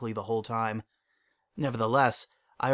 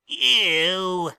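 put-on disgusted kid; 7 semitones higher, 7 dB louder than usual. Child disgusted.

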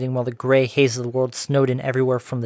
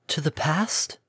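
disbelieving echo; 3 semitones higher, 5 dB quieter, slower than usual. A narrator's reading. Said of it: "Her face curved in disbelief".

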